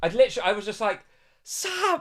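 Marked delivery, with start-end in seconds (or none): 1.4-2.0 high-pitched retold shout of a name; retelling how he shouted her name in disbelief, high; mock shout. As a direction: yell his name, pitch flying up. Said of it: "I shouted it".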